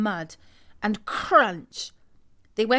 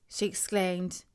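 plain reading voice

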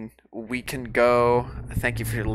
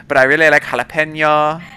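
mock Spanish accent; 4 semitones higher, 9 dB louder than usual. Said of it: "you say it with a Spanish accent".

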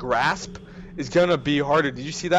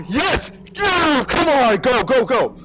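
loud, high relieved cheers; excited cheering; 6 semitones higher, 4 dB louder, slower than usual. Real, not acted. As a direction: cheer it on, half crying. Escaping, cheering.

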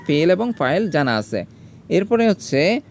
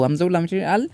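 normal talking voice

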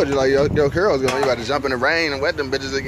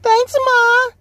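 very high falsetto echo; exaggerated high-pitched mimicry; mimicking thanks; 4 dB louder than usual. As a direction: squeal it back in a tiny high voice. Echoes the other's thanks.

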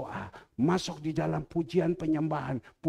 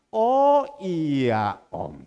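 loud, very slow acted "oh yes"; exaggerated retold agreement, long and loud; drawn-out realization; 8 dB louder, much slower than usual. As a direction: stretch it out as the point finally lands. Friend's agreement after clarification, acted.

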